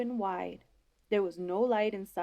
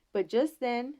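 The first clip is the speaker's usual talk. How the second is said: usual speaking voice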